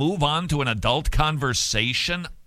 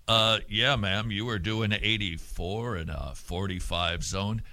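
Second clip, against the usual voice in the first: lower, softer officer voice; mock police officer; 6 semitones lower, 4 dB quieter than usual. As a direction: play the patient cop at the car window.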